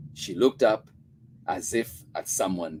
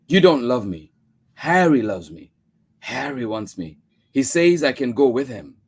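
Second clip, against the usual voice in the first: put-on cold child; 6 semitones higher, 10 dB louder than usual. A narrator's reading. Child accuses mother.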